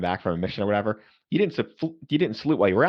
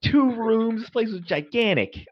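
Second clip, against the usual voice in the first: marveling; 11 semitones higher, slower than usual. Real, not acted. Marveling at huge room.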